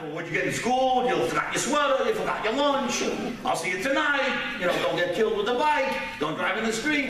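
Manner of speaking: a hint of parental nagging, rattled off as a list; mock parent firing off reminders; lightly mimicked nagging parent.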